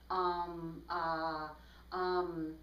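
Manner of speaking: imitation of hesitating for time; verbal pauses. Shown as a demonstration. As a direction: stall with droning ums and uhs. Mimicking filler sounds while thinking.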